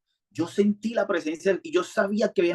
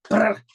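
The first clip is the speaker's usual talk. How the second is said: lips buzzed like flapping wings; a quick loud mouth flutter of wings; mock angel wingbeat; imitated wing flutter; 5 semitones higher, 5 dB louder than usual. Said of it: "escuché un aleteo".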